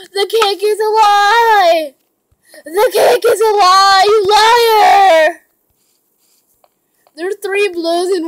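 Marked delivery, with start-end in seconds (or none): 0.1-5.5 loud betrayed shout; outraged yelling. Real, not acted. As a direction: yell it like you've been cheated. Cake trick fails in game.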